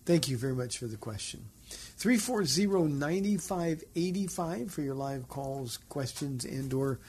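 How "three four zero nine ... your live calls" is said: radio number announcement. Real, not acted. Host reads out call-in number.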